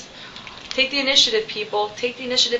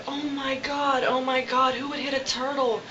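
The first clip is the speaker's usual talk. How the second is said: mildly indignant, higher-pitched retelling; a touch of outrage, raised in pitch but not volume; imitated pissed-off rant; 4 semitones higher, slower than usual. Upset man finding an injured turtle, mildly imitated indignant rant. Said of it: "He was, like, so pissed".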